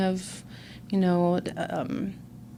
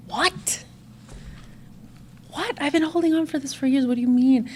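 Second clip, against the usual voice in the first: much higher, fast, incredulous questions; stunned disbelief; 6 semitones higher than usual. Retold or imitated. Hearing her guilt was never hers, stunned disbelief. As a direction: squeak out your shock at the news.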